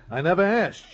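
high, loud, insistent repeat; exasperated outburst. Acted. Husband pressed again by Clover, exasperated loud retort.

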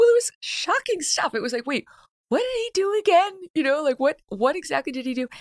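high, squeaky mock disbelief; mock incredulous voice. Host mocking the confused activists, squeaky and high. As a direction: pitch way up playing the baffled crowd.

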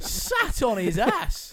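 loud, high and stretched out; real excited shout. Real, not acted. Watching a fighter get dropped, excited shout. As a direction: yell it in amazed glee.

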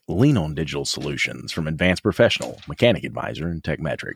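low radio-announcer read. Acted. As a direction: drop low like a radio ad spot. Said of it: "you're doing the radio voice".

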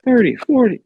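high, loud old politician impression; Joe Biden. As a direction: burst out like an indignant ageing president. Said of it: "that's my Biden".

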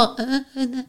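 soft imitated moaning; faint moans.

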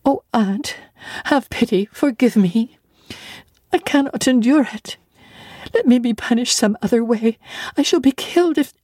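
put-on child begs her aunt to spare her, frantic and pitched high; frantic pleading. A narrator's reading. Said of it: "my frantic anguish and wild sobs".

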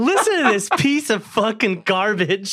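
slow, high mock call to the crowd; mock announcement. Comedian pretending to trash the other comic to the crowd. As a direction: call out to the room like a heckler. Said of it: "Hey, everybody".

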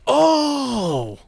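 high slow dawning oh; amazed realization, drawn out; sudden realization. Retold or imitated. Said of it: "I'm like".

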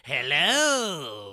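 loud booming robot greeting; robot troll voice. Pretend. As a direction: boom out a hello as a troll guard.

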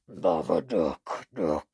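very low monster croak; Babadook. Monster saying its own name, deep creature voice. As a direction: drop deep and chant it as a creature. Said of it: "All the Babadook says is".

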